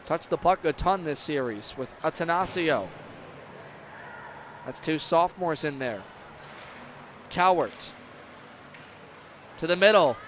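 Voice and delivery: ordinary talk, nothing marked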